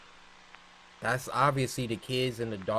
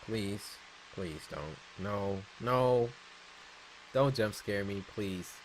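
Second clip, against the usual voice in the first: soft, fearful pleading; scared horror game streamer; 4 semitones lower, 6 dB quieter than usual. Real, not acted. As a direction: beg under your breath, scared. Dreading jump scare in game.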